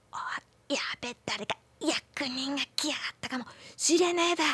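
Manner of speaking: hushed rough peasant whisper. Acted. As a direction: whisper in sudden alarm. Peasants hiding, whispered alarm.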